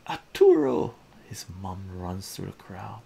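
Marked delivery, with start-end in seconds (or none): 0.1-0.9 very high, very loud cry of his name; a mother's piercing shout; mother calling out. Acted. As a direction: call her son across the crowd. Mom runs through the crowd calling.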